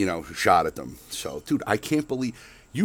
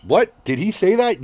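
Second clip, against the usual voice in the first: mock surprise; 10 semitones higher, 7 dB louder than usual. Mock shocked listener reacting.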